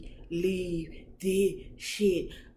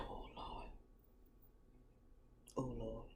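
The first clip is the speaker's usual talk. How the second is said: much softer whispered moan; breathy whisper; 5 semitones lower, 19 dB quieter than usual. Retold or imitated. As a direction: whisper it, surrendering. Moaning whisper in bed.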